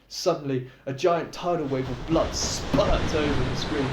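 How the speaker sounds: usual speaking voice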